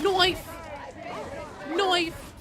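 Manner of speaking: loud, high-pitched yell of knife; shouted callout. Real, not acted. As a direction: shout the warning at the team. Calling out knife in game.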